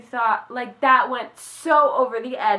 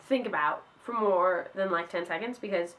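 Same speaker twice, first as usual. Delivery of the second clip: usual speaking voice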